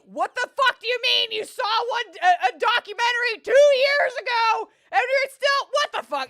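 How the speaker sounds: high, very loud incredulous rant; outraged yelling. Acted. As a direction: scream it in exasperated disbelief. Mock-outraged rant at cowboy character.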